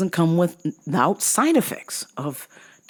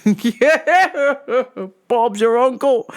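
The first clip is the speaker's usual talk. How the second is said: mock Aussie; 7 semitones higher, 3 dB louder, much slower than usual. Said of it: "Aussie pilot bit continues".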